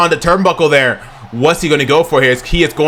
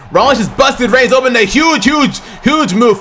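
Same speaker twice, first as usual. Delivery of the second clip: higher, shouted repeat of the call; excited shout; 6 semitones higher than usual. Real, not acted. Commentator hyping the face buster, shouting higher. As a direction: yell it the second time with full hype.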